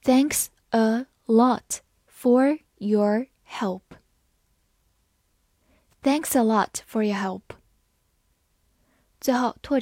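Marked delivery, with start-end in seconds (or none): 0.0-3.8 slow, careful model reading; read slowly. Shown as a demonstration. Teacher reading slowly so students can follow. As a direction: give every word its own beat. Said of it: "好，慢慢来读".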